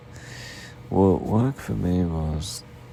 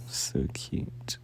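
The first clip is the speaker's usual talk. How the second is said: whispered coo; 7 dB quieter than usual. Acted. Cooing over sleepy partner.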